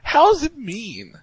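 high, drawn-out protest; squeaky-high, slow indignant question. Real, not acted. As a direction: push back in a high, stretched voice. Co-host mimics the outraged protest.

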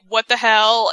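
ordinary talk

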